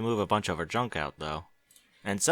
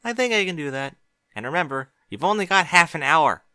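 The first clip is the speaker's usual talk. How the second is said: put-on higher, eager young mare voice; Twilight's voice; 7 semitones higher, 5 dB louder than usual. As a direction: ask him kindly and brightly.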